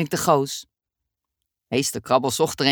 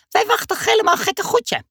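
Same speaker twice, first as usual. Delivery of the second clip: put-on much higher, eager little boy; Dummy's high child voice. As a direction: squeak it out like an impatient kid. Dummy's voice in story. (hoge kinderstem, ongeduldig)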